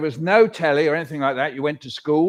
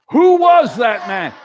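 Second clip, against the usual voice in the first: called-out question; 4 semitones higher, 8 dB louder, slower than usual. Acted. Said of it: "Somebody says".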